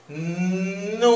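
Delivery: "Nudo" spoken slowly. Shown as a demonstration. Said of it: "lo decimos despacito".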